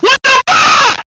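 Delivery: huge, high, drawn-out yell of disbelief; incredulous shout. Acted. Roasting James's one-and-nine record, a huge disbelieving shout. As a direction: bellow it at the one-and-nine team. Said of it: "That's what I have to say to you".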